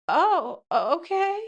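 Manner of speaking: high, overwhelmed little voice; pretend girlfriend. Girlfriend told to get the whole set done, high overwhelmed imitation. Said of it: "And she's like".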